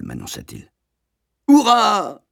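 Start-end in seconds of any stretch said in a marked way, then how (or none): 1.5-2.2 much higher, louder cheer; joyous shout. A narrator's reading. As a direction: leap for joy and yell it. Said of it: "vociférai-je en sautant de joie". (cri de joie)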